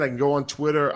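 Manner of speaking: ordinary talk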